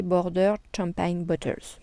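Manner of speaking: English label read in a French accent; self-mocked English accent. Real, not acted. Vlogger reads a craft label to viewers, in French-accented English. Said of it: "mon superbe accent anglais".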